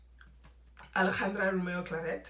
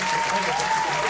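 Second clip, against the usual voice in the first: very high, fast, louder mock sympathy; fussing counselors; 9 dB louder, faster than usual. Counselors at school fussing over him, high fast mock pity. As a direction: gush like flustered counselors. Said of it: "And they were like".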